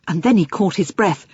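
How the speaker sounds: plain reading voice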